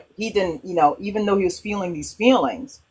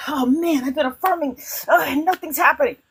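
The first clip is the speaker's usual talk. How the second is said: higher, sulky self-pity; mock discouraged whine; 9 semitones higher than usual. Someone whose affirmations aren't working, mock whining. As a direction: moan as if giving up on it. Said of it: "if you succumb to it".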